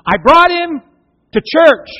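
high, loud, fed-up outburst; exasperated complaint. Retold or imitated. A father telling Jesus the church failed, loud and fed-up. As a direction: blurt it out like a frustrated dad.

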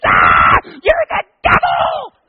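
shrieked high and loud, stretched out; terrified scream. Retold or imitated. Girl falling backwards, screaming at the masked pastor. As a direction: scream it as you fall backwards. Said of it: "she screams".

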